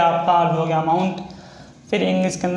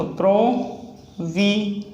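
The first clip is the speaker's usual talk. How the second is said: drawn out slowly; 2 semitones higher, much slower than usual. Shown as a demonstration. Teacher spelling out provenance for shorthand students, very slowly. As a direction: say provenance slowly, every syllable stretched. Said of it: "next है provenance".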